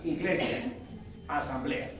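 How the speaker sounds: normal talking voice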